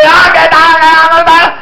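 high, loud, rapid-fire challenge; aggressive confrontation. Acted. Preacher acting someone picking a fight, aggressive. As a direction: come at them spoiling for a fight.

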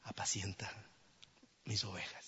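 soft whispered command; gentle whisper. Acted. The Lord gently telling Peter, softly whispered. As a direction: say it tenderly, quietly.

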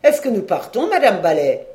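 loud called-out question; calling out. A narrator's reading. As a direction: shout over to her, asking. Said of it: "Il s'écria". (question criée, bien fort)

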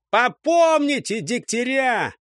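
high, drawn-out shouted threat; threatening yell. A narrator's reading. Катёха threatens the squad from above, threatening yell. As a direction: holler the threat across the distance.

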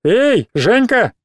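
high, drawn-out taunting call. A narrator's reading. Classmate calling Zhenya from the back desk, calling out. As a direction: holler a name, stretched, without real volume. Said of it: "крикнули с задней парты".

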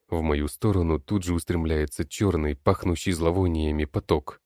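ordinary delivery

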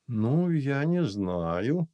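slow sighing doubt. Acted. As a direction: sigh it out slowly. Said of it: "вздохнула Любора". (вздыхая, медленно)

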